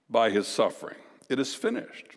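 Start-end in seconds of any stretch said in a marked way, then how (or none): 1.3-2.1 softly repeating It is finished, much softer; quiet echo. Acted. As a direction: let it settle, hushed and reflective.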